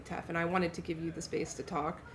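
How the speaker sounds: ordinary delivery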